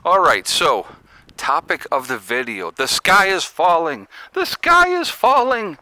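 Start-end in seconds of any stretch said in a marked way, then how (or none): 2.7-4.1 slow mock-alarmed cry; mock Chicken Little. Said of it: "Let's be Chicken Little". 4.3-5.8 much higher, slow alarmed wail; Chicken Little panic. Pretend. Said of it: "Let's be Chicken Little".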